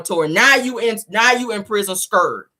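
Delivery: ordinary talk, nothing marked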